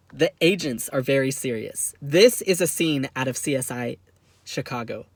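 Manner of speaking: normal talking voice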